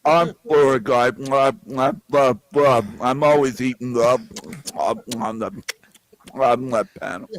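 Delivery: halting, chewing between words; mock talking with stuffed cheeks; mouth-full Tarek impression. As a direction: mumble through a pretend mouthful. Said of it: "impression of Tarek while he's eating".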